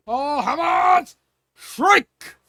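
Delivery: high, loud, drawn-out call; slow stretched battle shout; shouted command. Acted. King ordering his soldiers, high stretched shout.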